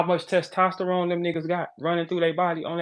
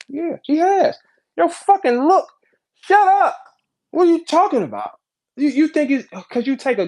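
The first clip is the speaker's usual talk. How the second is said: heated; 11 semitones higher, 6 dB louder than usual. Real, not acted. Scolding women in audience.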